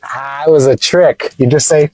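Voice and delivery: ordinary talk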